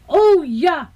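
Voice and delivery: high, delighted exclamation; thrilled little girl. A narrator's reading. Rika sees the mother bird arrive, delighted child.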